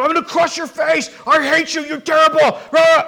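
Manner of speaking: hammed-up furious threats; riled up. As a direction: play God all riled at Satan. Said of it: "he doesn't get all riled".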